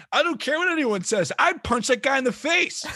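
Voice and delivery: high, loud outraged outburst; Jeff Van Gundy. Retold or imitated. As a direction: shout it high, like an indignant sportscaster. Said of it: "Van Gundy would be like".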